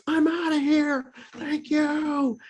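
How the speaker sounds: high, carried shout of thanks; happy call across the street. Retold or imitated. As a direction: call back joyfully from far away. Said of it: "I could hear him all the way across".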